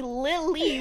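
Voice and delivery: "Lily" broken into two loud syllables; "Lily" stretched as LIL-LEE in mock outrage; two-syllable emphasis. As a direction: spell out both syllables of "Lily". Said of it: "with two syllables".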